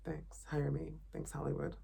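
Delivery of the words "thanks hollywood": ordinary delivery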